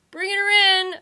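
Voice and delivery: high, loud confirming call; a third voice confirms it; affirming call. A narrator's reading. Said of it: "affirmed a third".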